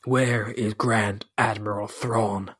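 low, slow and menacing, like a cold grand admiral; slow it right down and let the menace sit in every word; menacing villain voice. Acted. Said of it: "That's so good".

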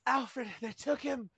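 a hammed-up damsel in distress; mock damsel panic. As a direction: play the fainting heroine, hammed-up. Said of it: "it was like".

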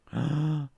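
a hammed-up gasp of shock; mock gasp. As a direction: gasp as if scandalised. Reacts to bombshell news.

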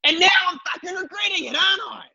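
high, hurried comic wail; mock panic. Hunted salesman regrets his ads, mock-panicked cry. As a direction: shout regret fast and high, hammed-up.

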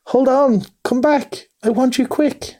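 high, urgent, excited calling; eager calling. A narrator's reading. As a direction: call after a friend who is walking away. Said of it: "full of joyful excitement".

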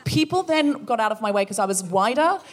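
usual speaking voice

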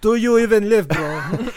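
gym bro voice. Pretend. Mocks gym bro attitude.

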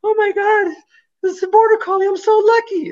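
over-the-top high-pitched delight; mock excited gushing. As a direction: gush like a thrilled shelter adopter who can't believe their luck. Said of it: "people get all excited".

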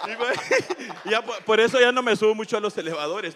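loud, high nervous laughter into words. Real, not acted. Congregant laughing nervously about his elevator fear. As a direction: laugh off the embarrassment.

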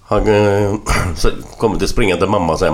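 ordinary talk

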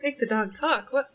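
ordinary talk, nothing marked

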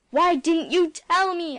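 loud, slow, furious demand; furious cry. A narrator's reading. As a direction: hurl the question at him. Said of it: "I cried".

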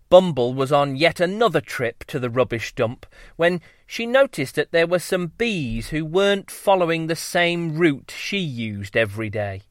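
ordinary delivery

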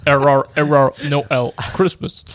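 loud, slow malfunctioning robot voice; robotic error message. Pretend. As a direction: glitch out like a broken robot. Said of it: "Si tu peux le faire un peu robotisé".

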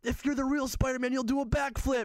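put-on high-pitched child's challenge; little girl. Little girl testing whether he is real Spider-Man, imitated high voice. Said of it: "one little girl's like".

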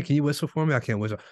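usual speaking voice

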